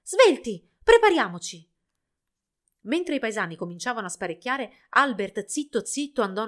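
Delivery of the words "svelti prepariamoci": high, loud urgent cry; rally everyone with an excited shout; excited shriek. A narrator's reading. Village girl hurrying the villagers, excited shriek. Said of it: "balzando dalla sedia per l'emozione".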